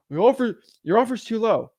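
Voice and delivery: put-on high complaint; mock complaining seller. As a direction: play the seller protesting the offer. Acts out seller's objection.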